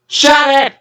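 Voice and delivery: high, loud barked order; shouting. A narrator's reading. Captain Zero shouts down the protesting tugs. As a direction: snap them silent. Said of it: "shouted Captain Zero".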